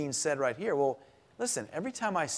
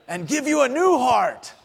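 hint of a thick Dutch accent, rising higher and louder; 6 semitones higher, 4 dB louder than usual. Professor lecturing the class in a Dutch accent. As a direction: imitate the Dutch professor's accent, building to a near shout. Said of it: "spoke in this thick Dutch accent".

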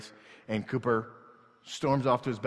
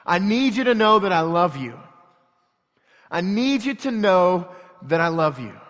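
loud, high, heartfelt insistence; impassioned plea; 5 semitones higher, 8 dB louder than usual. Acted. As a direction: press your love on an upset son. Father pleading love to son.

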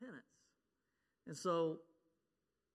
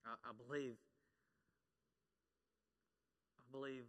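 real emotion, softer, voice catching; holding back tears as he pleads; choked up; 3 semitones lower, 8 dB quieter than usual. Real, not acted. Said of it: "I'm getting choked up".

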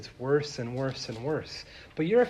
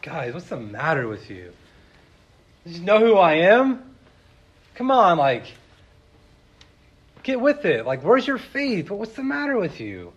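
rebuking; 3 semitones higher, 7 dB louder than usual. Retold or imitated. Jesus scolding his disciples for lacking faith, loud rebuke. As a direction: drive the rebuke home. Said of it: "Jesus is kinda rebuking the disciples".

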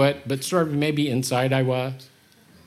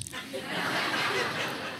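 laughing; 10 dB quieter than usual. Real, not acted. Laughing at own joke.

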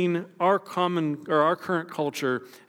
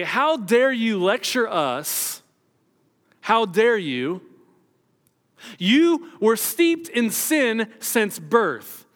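slow, raised, outraged condemnation; indignant rebuke; 6 semitones higher, 4 dB louder, much slower than usual. Retold or imitated. Pharisees scolding the healed man, slow and raised in outrage. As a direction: drag out the outrage at him. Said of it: "they begin to argue with him".

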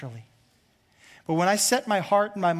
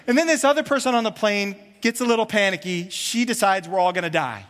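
ordinary talk, nothing marked

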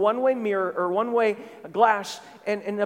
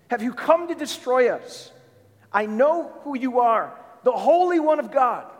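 higher-pitched demonic challenge, building louder; crying out; 4 semitones higher, 4 dB louder than usual. Acted. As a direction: cry it out as the possessed man, voice raised. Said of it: "what he cries out".

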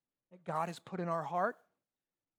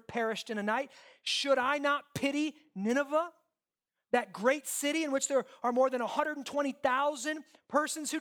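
loud, high declamatory scripture reading; preach; 7 semitones higher, 5 dB louder than usual. Acted. Preacher reads God's words to Jonah, declaiming. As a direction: proclaim the verse with raised, driving force.

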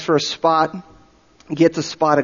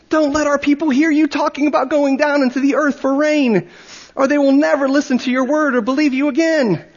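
put-on tender old chief; 11 semitones higher than usual. A narrator's reading. Old chief tenderly warning the missionary, much higher voice. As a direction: soothe a friend who has lost his mind. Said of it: "grew more tender in his tones and cried".

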